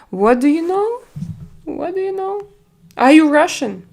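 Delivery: high, rising playful challenge; mock amazed question. Real, not acted. Streamer teasing a viewer in chat, with high mock amazement. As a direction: tease them, eyebrows up.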